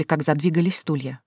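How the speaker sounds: ordinary delivery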